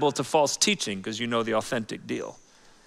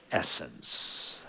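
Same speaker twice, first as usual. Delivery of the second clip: hushed essence; 11 semitones lower, 7 dB quieter than usual. Acted. Dropped key word in sermon.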